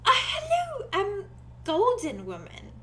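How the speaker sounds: high, hesitant, slowing at the end; shy character voice. Acted. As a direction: greet a stranger shyly. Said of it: "Arthros goes".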